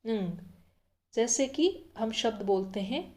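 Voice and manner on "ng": ng said as a nasal hum like the end of ring; ng sound. Shown as a demonstration. Teacher sounding the nasal ng for students. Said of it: "इसका उच्चारण होता है".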